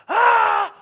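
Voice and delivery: a sudden furious scream of frustration; angry yell. Retold or imitated. Young driver yelling in rage before punching the windshield. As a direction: scream out in rage like you're about to punch something.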